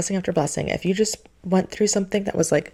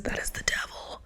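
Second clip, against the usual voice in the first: whisper; 11 dB quieter than usual. Acted. Warning about the devil's lies.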